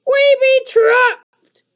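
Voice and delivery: very high, slow, loud squeal; a panicked goblin squealing; squealing creaker. A narrator's reading. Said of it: "squealed Sniff".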